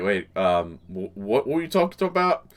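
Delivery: ordinary talk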